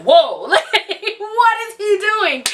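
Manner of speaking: high, stretched-out astonished whoa; amazed exclaim. Retold or imitated. Hospital staff amazed at newborn lifting head, high astonished whoa. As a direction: play stunned staff gawking at a baby. Retold doctors reacting to baby.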